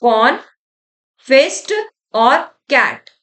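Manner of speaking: "कॉन, fist और कैट" slowly, with a long pause after "कॉन"; broken into parts. Shown as a demonstration. Teacher splits the word to memorise it, slow separated parts. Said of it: "तीन part में तोड़ते हैं".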